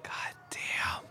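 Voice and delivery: real hushed whisper; awed whisper. Real, not acted. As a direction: breathe it out, stunned. Awed at movie ending.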